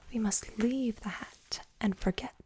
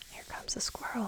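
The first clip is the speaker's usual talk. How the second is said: ordinary delivery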